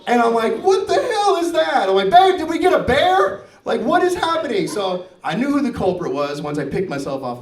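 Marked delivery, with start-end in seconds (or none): 0.6-1.9 high-pitched, aghast question; acted startled outburst; alarmed disbelief. Retold alarm on seeing the toilet. 2.1-4.7 high-pitched, aghast question; acted startled outburst; alarmed disbelief. Calls to wife in retold alarm.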